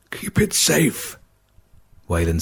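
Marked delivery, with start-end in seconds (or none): 0.1-1.2 slow, higher strained words of a dying man; dying plea. A narrator's reading. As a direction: force it out with the last strength. Dying father's last request.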